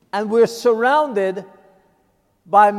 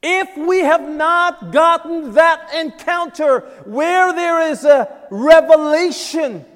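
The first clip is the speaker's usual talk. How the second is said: raised, loud pulpit voice, pressing each phrase; preaching at full force; 8 semitones higher, 7 dB louder than usual. Acted. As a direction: preach it out to the whole room. Preaching to congregation.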